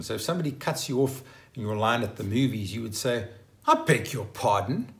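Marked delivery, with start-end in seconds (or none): 3.7-4.9 affronted challenge with a sharp questioning lift; indignant. Shown as a demonstration. To somebody cutting you off in line at the movies, indignant. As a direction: take offence and throw it back indignantly. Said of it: "much more of an indignant statement".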